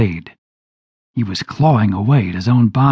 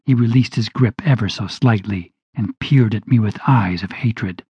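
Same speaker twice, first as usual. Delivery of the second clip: ordinary delivery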